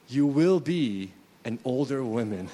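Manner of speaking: normal talking voice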